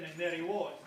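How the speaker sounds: ordinary delivery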